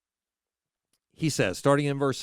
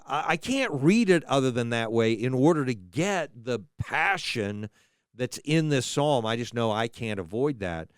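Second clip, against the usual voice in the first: ordinary talk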